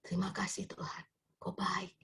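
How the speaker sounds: hushed, whispered prayer with long pauses; imitated tearful prayer. Preacher voices a bereaved mother thanking God, hushed tearful prayer. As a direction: whisper thanks as if through grief. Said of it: "dia nangis".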